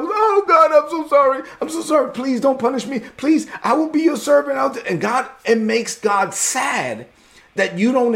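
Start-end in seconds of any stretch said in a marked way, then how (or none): 0.0-4.8 much higher, loud pleading wail; groveling plea. Retold or imitated. Preacher mimics a believer begging God for mercy, high wailing plea. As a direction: beg God like a frightened servant.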